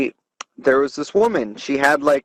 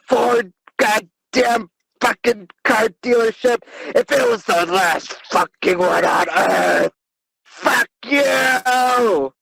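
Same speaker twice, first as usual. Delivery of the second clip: furious yell; 8 semitones higher than usual. Retold or imitated. Retelling his text to the manager, a hammed-up furious yell. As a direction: scream the insults, hammed-up fury. Angry retold text, yelled.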